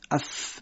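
اف as a breathy unvoiced puff; whispered th puff. Shown as a demonstration. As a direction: blow the th sound out.

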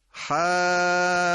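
long chanted حج; recited model. Shown as a demonstration. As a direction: sustain the madd in حج with doubled jim. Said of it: "یکی کلمهٔ".

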